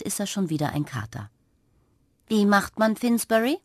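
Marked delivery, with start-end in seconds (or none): 2.3-3.6 stern. A narrator's reading. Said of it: "fragt Napperli ihren Sohn streng".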